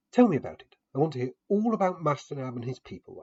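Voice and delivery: a hint of an eager hostess pressing for a tale.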